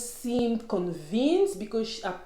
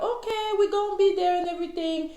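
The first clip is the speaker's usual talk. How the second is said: higher, chirpy imitation of the ladies agreeing; mock eager agreement; 10 semitones higher, faster than usual. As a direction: play the eager guests saying yes. Everybody's like, retold group reply.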